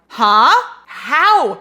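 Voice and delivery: baffled, almost shouting; incredulous huh. Retold or imitated. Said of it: "And I'm like".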